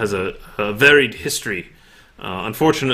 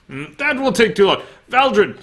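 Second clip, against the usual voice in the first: haughty noble voice; 10 semitones higher than usual. Acted. GM voices noble ordering servant.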